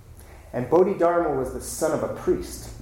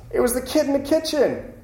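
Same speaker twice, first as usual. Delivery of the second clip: high, put-on pupil's voice; student blurting out; 9 semitones higher than usual. Student telling the abbot who wrote it, blurting it out. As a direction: pipe up suddenly, pitched way up. One student breaks silence, names author.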